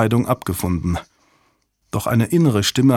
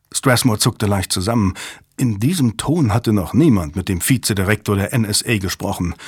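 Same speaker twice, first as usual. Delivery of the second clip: usual speaking voice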